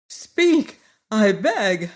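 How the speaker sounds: pleading cry. Acted. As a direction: beg him to answer, frightened. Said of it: "cried the Tin Woodman".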